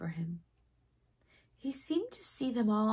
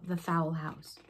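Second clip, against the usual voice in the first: ordinary delivery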